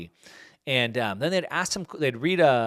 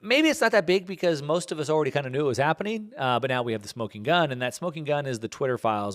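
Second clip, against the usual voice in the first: ordinary delivery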